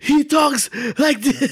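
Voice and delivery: high, slow raspy croak; tether impression. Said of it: "Forrest Whitaker is a tethered".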